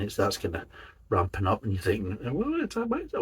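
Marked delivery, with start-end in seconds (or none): 2.2-3.2 much higher, softer mock-puzzled voice; high, hushed little voice of bewildered disgust; acted puzzled reaction. Beer reviewer acting out his thought as the taste hits, high puzzled voice.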